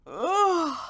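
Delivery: yawning groan; tired groan. Acted. Sleepy Mister Sniffer groaning as he yawns. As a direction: groan through a yawn.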